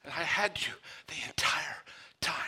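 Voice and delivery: breathy whisper, almost silent; whispered prayer. Acted. Believer whispering thanks to God.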